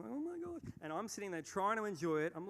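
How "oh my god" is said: exasperated sigh. Retold or imitated. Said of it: "like, going".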